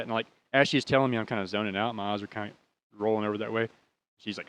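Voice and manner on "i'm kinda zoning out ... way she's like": usual speaking voice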